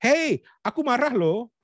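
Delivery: high, drawn-out mock anger; unconvincing anger. Shown as a demonstration. Coach shows how no angry person would sound, unconvincing high anger. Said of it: "Nggak mungkin orang marah langsung".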